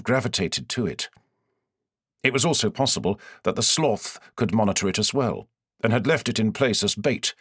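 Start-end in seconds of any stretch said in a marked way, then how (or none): none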